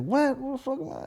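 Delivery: very high, squeaky confused imitation; mock bewilderment. Host mimicking comedian asking where he is, high bewildered voice.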